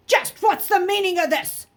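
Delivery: loud booming demand, high and forceful; booming man. A narrator's reading. Stern man catching boys fighting, booming. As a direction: boom it down at the boys. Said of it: "a man's voice boomed above them".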